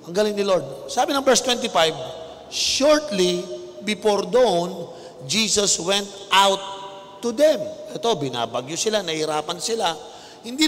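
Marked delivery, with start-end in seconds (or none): 2.5-7.6 scripture reading. Acted.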